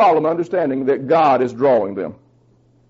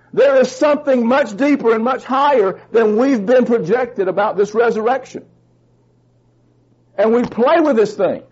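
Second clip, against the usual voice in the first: fervent pulpit delivery, pitched up; preaching at full force; 5 semitones higher than usual. Real, not acted. Pastor pressing scripture on his congregation, fervent preaching. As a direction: drive each verse home to the pews.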